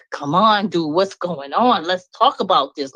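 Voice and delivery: usual speaking voice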